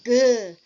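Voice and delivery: "G" pushed out loud from the throat; throaty G. Shown as a demonstration. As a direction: voice a strong "G" you can feel in the throat. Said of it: "you wanna feel that G in the throat".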